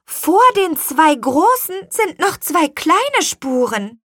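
put-on high, loud child voice; very high little-girl voice; excited girl. A narrator's reading. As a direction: report a discovery excitedly. Girl excitedly reports her discovery.

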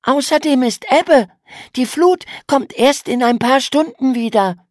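viel höhere Mädchenstimme, leicht trotzig; hoch und lauter, abwiegelnd; Mädchen erklärt. A narrator's reading. Emma widerspricht. (Schwester beruhigt den Bruder, hohe Mädchenstimme)

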